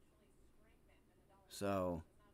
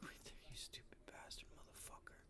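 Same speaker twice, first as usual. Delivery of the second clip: whispered curse; 24 dB quieter than usual. Real, not acted. Cursing the chart under his breath.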